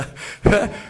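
a short unvoiced laugh mid-quote; breathy laugh. Real, not acted. Rabbi laughs while retelling his scolding of a young man. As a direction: laugh through the retold scolding.

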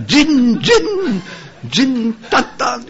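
high, hammed-up terrified cries; panicked screaming. Mob thinks the corpse is a demon, acted panicked screams. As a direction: wail it in mock panic. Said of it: "זה התחילו לצעוק".

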